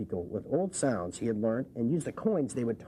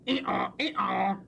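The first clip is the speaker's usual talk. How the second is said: donkey bray; 4 semitones higher than usual. Pretend. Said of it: "The donkey sang".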